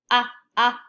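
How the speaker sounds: loud open ah twice; opening ah of opposite; short o sound. Shown as a demonstration. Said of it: "opposite starts with ah".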